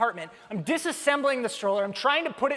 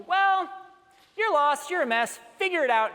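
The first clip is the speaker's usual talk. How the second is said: higher, louder curt brush-off; dismissive scold; 6 semitones higher, 4 dB louder than usual. Pretend. Christians dismissing a struggling person, curt. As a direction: lecture them coldly. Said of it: "do we sometimes tell people".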